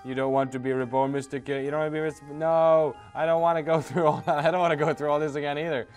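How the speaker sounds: ordinary talk, nothing marked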